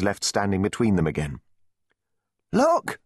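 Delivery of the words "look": very high, sudden call; alarmed cry. A narrator's reading. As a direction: cry out to alert everyone. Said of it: "cried Joan".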